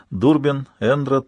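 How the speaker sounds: ordinary delivery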